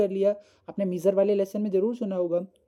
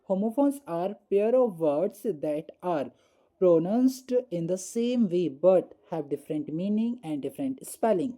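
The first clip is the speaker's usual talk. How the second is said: very slow, word by word reading; slow dictation; much slower than usual. Shown as a demonstration. Teacher dictating definition slowly to class, very slow. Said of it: "पहले इसका डे-, definition देखेंगे".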